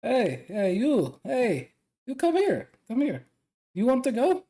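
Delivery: hey-you summons, lifting into a teasing question; calling someone over. Acted. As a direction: call out to get their attention, pitch jumping. Calling over a sulking student.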